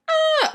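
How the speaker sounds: high, loud horror-movie scream; mock scream. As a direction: let out a wild scream. Wendy screaming outside.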